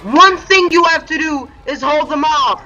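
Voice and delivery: loud, high frustrated lecture; angry scolding shout. Real, not acted. Gamer scolding teammate for failing her one job, angry shout.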